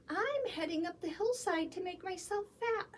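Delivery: high and quick little goat voice; squeaky little goat. A narrator's reading. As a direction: chirp it out fast and high. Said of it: "the littlest billy goat squeaked".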